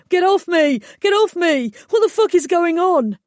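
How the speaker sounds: loud, high, frantic cries to be let go; panicked yelling. A narrator's reading. Trapped fairy yelling at the unseen attacker, loud and panicked. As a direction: scream to get free of the net.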